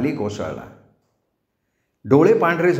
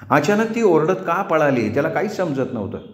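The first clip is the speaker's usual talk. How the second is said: ordinary delivery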